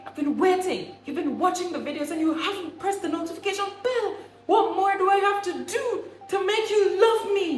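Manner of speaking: wired and hyper, pitched high; over-caffeinated begging; too much caffeine. Real, not acted. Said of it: "I am wired right now".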